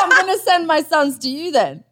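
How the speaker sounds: laughing through the words. Real, not acted. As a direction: laugh while saying it. Laughing after the crude joke.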